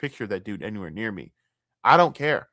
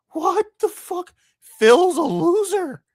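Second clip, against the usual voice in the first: much higher, slow, strained disbelief; high-pitched exasperation; 7 dB louder, slower than usual. Real, not acted. Streamer reacting to a Phil tattoo, high slow outraged squeal.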